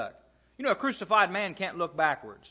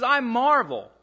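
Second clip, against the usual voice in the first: "I marvel" said higher and louder in amazement; astonished; 6 semitones higher, 5 dB louder, slower than usual. Shown as a demonstration. Preacher acting out Paul's astonishment at the Galatians, higher and louder. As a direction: land "I marvel" like you can't believe it. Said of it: "I can't believe it".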